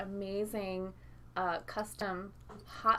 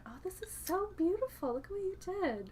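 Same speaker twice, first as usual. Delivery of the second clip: delighted whisper; 9 semitones higher, faster than usual. Real, not acted. Admiring the garnished cocktail.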